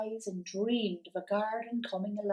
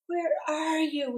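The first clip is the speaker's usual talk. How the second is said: a slow, higher, gentle plea; pleading; 6 semitones higher, slower than usual. A narrator's reading. Father calling to his dead wife in a dream, mild pleading. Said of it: "he pleaded".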